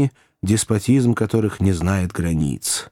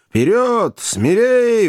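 high, exultant cheer; delighted shouting; 13 semitones higher, 4 dB louder, much slower than usual. A narrator's reading. Joe cheers on the towing elephant, shouting in delight. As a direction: urge them on in high delight. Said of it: "в восторге кричал Джо".

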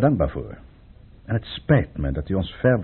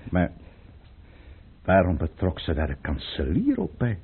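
ordinary talk, nothing marked

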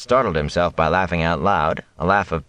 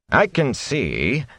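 pointed; 8 semitones higher, much slower than usual. A narrator's reading. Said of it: "with a sidelong look at the thatcher".

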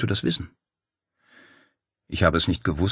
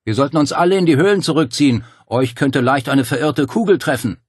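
higher, louder, fast urging shout; calling out; 7 semitones higher, 6 dB louder than usual. A narrator's reading. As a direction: hurry them out, shouting over the noise. Dario calling across the plateau.

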